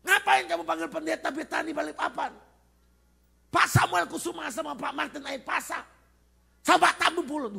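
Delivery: high, loud demonic yelling; possessed girl screaming. Retold or imitated. Possessed girl yelling at visitors from inside, high mock scream. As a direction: yell it high like a raging demon. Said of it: "tiba-tiba teriak-teriak".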